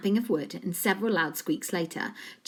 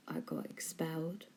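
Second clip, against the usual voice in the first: whisper; 13 dB quieter than usual. A narrator's reading. A J confessing.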